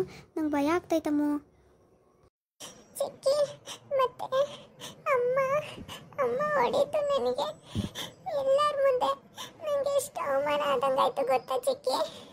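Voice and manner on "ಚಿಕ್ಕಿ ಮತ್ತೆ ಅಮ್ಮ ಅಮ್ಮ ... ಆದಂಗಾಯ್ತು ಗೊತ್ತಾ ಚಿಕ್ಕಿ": put-on high, slow, sobbing child's voice; a hurt little girl pouring out her grievance through tears; crying little girl. Niece telling her aunt her mother hit her, high sobbing child voice.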